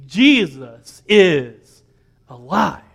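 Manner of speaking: drawn out slowly, each word weighed; slow reverent quote. Retold or imitated. Pastor retelling his daughter's words, slow and deliberate. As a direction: let it land slowly like a child's sermon. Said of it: "she preached to me".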